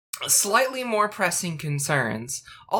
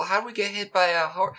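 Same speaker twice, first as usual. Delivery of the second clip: ordinary talk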